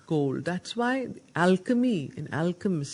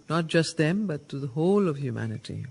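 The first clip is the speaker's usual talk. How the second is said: ordinary delivery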